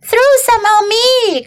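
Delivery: put-on high-pitched, eager boy; Avery crying out. A narrator's reading. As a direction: pipe up like an excited child. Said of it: "cried Avery".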